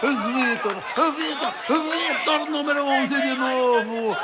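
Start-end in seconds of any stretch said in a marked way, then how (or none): 0.0-2.5 excited. Real, not acted. Celebrating the scorer's name.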